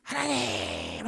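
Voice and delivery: very low, very slow drawn-out groan of God's name; inner groaning cry. Retold or imitated. Hannah crying inwardly to God, slow low groan. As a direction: drag the word out like a silent inner cry. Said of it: "하면서 소리 지르고 있는 거예요".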